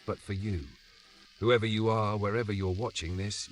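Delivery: usual speaking voice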